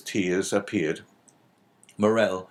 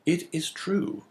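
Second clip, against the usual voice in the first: plain reading voice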